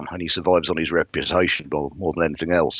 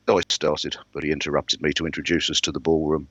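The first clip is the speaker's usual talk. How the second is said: ordinary delivery, nothing marked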